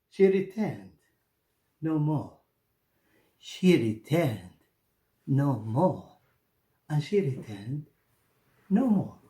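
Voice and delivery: soft, fading refrain; quiet closing echo; story ending. A narrator's reading. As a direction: let the ending die away.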